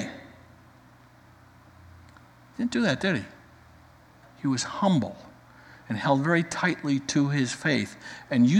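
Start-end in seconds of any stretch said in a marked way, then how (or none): none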